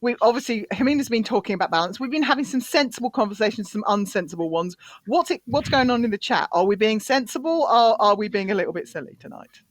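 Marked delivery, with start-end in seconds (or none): none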